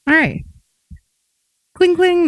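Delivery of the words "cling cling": a sung little door chime, cling cling; door bell imitation. Shown as a demonstration. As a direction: ring it out high like a shop bell. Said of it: "That's the sound of the, uh, the bell on the door".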